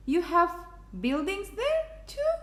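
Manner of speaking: astonished high question; gasped. A narrator's reading. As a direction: rise high in amazement. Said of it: "Hardin gasped".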